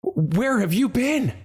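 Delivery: where have you been said high and pleading; shocked question. A narrator's reading. Guard crying out to Rhea, distressed.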